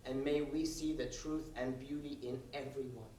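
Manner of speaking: soft, hushed mock prayer. Comic leading the crowd in a hushed mock prayer. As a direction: pray softly with fake solemnity.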